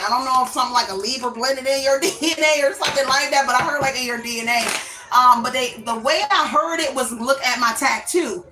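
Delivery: ordinary delivery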